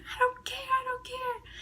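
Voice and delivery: very high, piercing "I don't care"; defiant shout. Retold or imitated. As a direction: fling it out as she storms off. Storming out of class, defiant.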